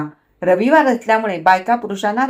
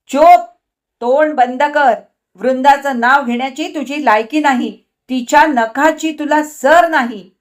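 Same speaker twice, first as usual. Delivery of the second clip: loud furious yell; shout; 4 semitones higher, 6 dB louder than usual. A narrator's reading. Husband shouts wife to shut up, furious. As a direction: roar her into silence.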